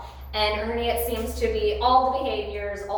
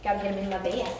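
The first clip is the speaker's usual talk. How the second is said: usual speaking voice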